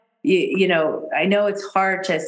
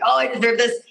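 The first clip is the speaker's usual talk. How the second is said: higher, hopeful inner voice; mock self-belief; 5 semitones higher, faster than usual. Speaker acting out her wobbly self-worth, mock self-belief. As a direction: play-act convincing yourself you're deserving.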